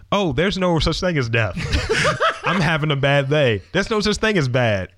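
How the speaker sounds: high laughing mock retort; laughing mockery. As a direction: laugh through the mocking punchlines. Mocking replies, laughing.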